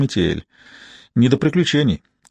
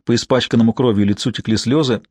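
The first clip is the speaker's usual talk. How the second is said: ordinary delivery, nothing marked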